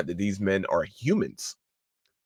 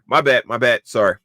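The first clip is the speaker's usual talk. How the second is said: normal talking voice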